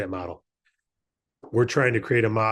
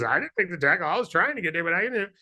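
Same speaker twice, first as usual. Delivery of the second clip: tick voice; 5 semitones higher, faster than usual. Acted. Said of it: "The little tick says".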